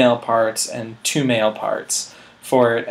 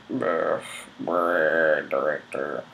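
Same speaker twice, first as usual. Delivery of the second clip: much lower, softer rumbling mockery; mock director grumble; 8 semitones lower, 6 dB quieter, much slower than usual. Mocking the silent director.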